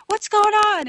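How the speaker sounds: high, loud call of alarm; alarmed shout. Retold or imitated. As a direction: call out sharply like a fireman arriving at a blaze. Firemen arrive at the blaze.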